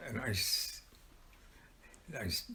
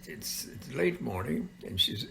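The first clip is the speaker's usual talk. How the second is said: ordinary delivery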